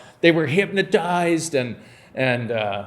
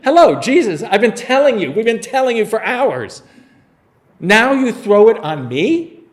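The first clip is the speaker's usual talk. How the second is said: higher and louder, laughing in mock exasperation; a half-laughing, incredulous disciple pushing back; exasperated Philip; 5 semitones higher, 5 dB louder than usual. Philip protests to Jesus, mock exasperated and laughing.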